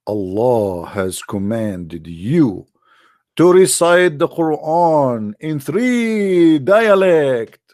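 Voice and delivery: slow, grand proclamation; mock solemn decree; solemn angel decree. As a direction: announce it slowly like a herald. Angel delivers solemn message.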